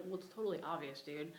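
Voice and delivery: ordinary talk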